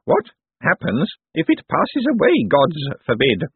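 put-on high little old-lady voice; elderly voice. A narrator's reading. Elderly aunt asks after the dog, high old voice. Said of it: "said an elderly voice".

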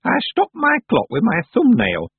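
put-on high, loud and proud old woman; old witch proudly pleased with her trick. A narrator's reading. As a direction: boast like a mischievous crone. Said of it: "said Miss Treason proudly".

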